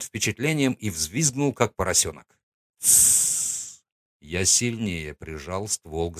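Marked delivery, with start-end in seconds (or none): 2.8-3.8 hissed shush, no voice; sharp shush. Acted. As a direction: cut him off with a hiss. Silencing squealing captive.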